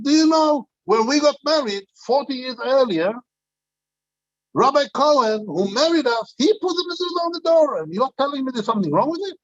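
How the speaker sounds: high, fast, offended protest; wife scolds the young rabbi, indignant and high. Retold or imitated. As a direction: play her up as scandalized and rushing. Wife defends husband's mezuzah.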